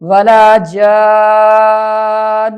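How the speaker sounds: long held vowel into doubled n; Quran recitation. Shown as a demonstration. Teacher reciting a phrase for learners, drawn-out recitation.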